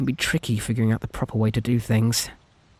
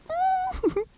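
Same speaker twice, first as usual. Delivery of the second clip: high, breathless burst of delighted laughter; giddy laugh; 5 dB quieter than usual. Acted. Captor laughing with joy that her captive is here. Overjoyed captor finally has listener.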